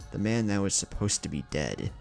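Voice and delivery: usual speaking voice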